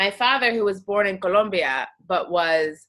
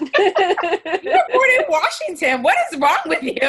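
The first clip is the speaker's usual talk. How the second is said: sister laughing at her; 11 semitones higher, 8 dB louder than usual. Retold or imitated. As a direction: crack up while teasing your sister.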